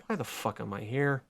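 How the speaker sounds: low, soft, fast and disdainful; a low, soft grumble of a jaded villain; bored villain. Retold or imitated. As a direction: mutter it like you'd rather be anywhere else. Sinestro voiced bored.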